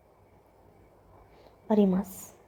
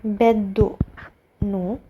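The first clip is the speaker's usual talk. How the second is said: बेदोनो drawn out very slowly; slow dictation pace for students copying; much slower than usual. Shown as a demonstration. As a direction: spell it out slowly as you write. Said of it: "तो यहाँ पे आप लिखोगे".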